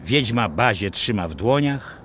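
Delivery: ordinary delivery, nothing marked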